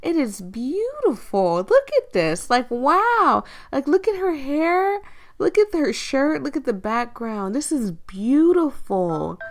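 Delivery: drawn-out awe with big high swoops; excited gushing. Real, not acted. Player gushing over the game's artwork, excited and awed. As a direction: gush over it, stretching the praise words. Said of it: "I'm so excited to play this".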